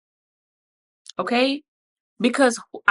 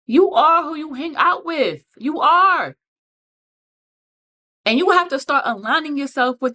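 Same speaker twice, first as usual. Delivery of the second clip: emphatic; 7 semitones higher than usual. Real, not acted. Emphatic lecture.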